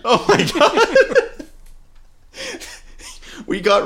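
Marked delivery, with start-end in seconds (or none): 0.0-1.5 laughing hard, high and breathless. Real, not acted. Laughing at Doug's stare, cracking up. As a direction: break up in real laughter.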